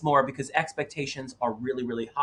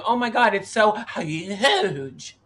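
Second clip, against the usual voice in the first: slow, drawn-out mock New York accent with a laugh; 5 semitones higher, slower than usual. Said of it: "I make fun of people like you by going".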